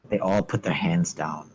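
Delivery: ordinary talk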